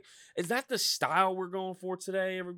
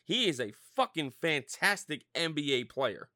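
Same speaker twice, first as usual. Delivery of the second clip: ordinary delivery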